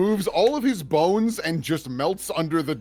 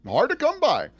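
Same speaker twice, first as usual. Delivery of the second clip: higher louder sword voice; 12 semitones higher, 5 dB louder than usual. Acted. Repeats it in sword voice.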